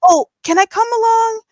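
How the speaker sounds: sweet caregiver voice. Retold or imitated. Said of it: "this lady was, like".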